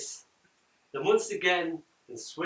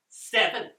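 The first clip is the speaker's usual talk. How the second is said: declaring; 6 semitones higher, 6 dB louder than usual. A narrator's reading. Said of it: "she declares".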